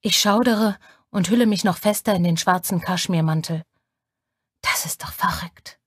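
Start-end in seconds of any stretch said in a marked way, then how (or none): 4.6-5.8 tonlos geflüstert, zu sich selbst; Flüstern. A narrator's reading. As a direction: hauch es fast lautlos vor dich hin. Innerer Gedanke im Taxi. (leises Flüstern im dunklen Taxi)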